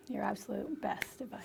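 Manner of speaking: whisper. Real, not acted. Whispered emphasis.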